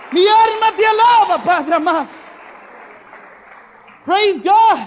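loud, rising preacher's cry on every phrase; preaching at full force. Acted. Preacher praising God before his brothers, loud preaching cry. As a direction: build the praise loud and strong.

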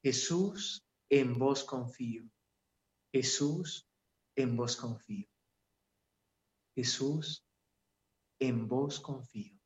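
calm murmured litany; slowing prayer rhythm. Shown as a demonstration. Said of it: "e ir bajando el ritmo".

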